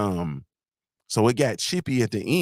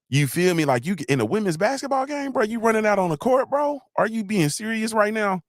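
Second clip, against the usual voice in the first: high-pitched disbelieving rant; incredulous; 10 semitones higher than usual. Acted. Fan scolding a man who ran onto the court, incredulous.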